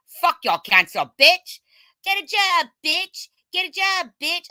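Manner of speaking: high mocking yell, loud; shrill taunting chant of insults. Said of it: "sick and tired of hearing".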